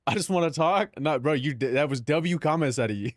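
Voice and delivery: ordinary talk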